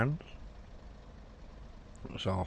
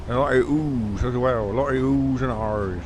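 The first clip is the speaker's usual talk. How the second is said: put-on West Country accent; 3 semitones higher, 3 dB louder than usual. Streamer joking about locals adding R's, mock West Country accent. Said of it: "Down here in Devon and Cornwall".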